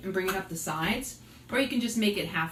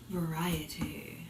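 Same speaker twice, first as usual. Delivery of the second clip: drawn-out soft whisper; slow whisper; 3 semitones lower, 4 dB quieter, much slower than usual. Real, not acted. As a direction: breathe the last word slowly. Emphatic final word.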